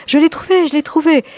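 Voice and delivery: high, loud cry of joy; joyful cry. A narrator's reading. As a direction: shout it out, overjoyed at the find. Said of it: "elle se mit à crier".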